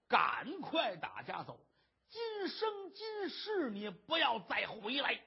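slow, drawn-out dramatic warning; grave urging. Acted. Urging him to flee home forever, gravely insistent. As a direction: stretch each word, rising high and insistent.

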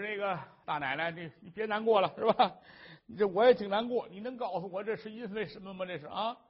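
fast, pleading husband voice; anxious husband. Acted. Husband coaxing his weeping wife, quick and pleading. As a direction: rush the words to comfort her.

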